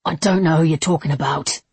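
usual speaking voice

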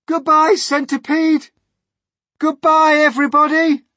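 high, loud parting cries; desperate gasped farewell. A narrator's reading. James says farewell to friends while falling, desperate cries. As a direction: call out last goodbyes in terror. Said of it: "gasped James".

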